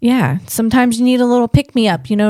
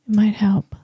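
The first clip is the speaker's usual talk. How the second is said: breathy whispered aside. Real, not acted. Echoing his own hope to viewers in a whisper. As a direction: whisper it to yourself. Whispered echo of previous line.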